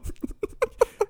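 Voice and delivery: high breathy giggle. Real, not acted.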